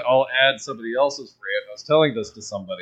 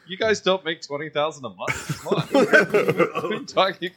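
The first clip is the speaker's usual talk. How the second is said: laughing mock disbelief; 6 semitones higher than usual. Real, not acted. Mocking his own money slip.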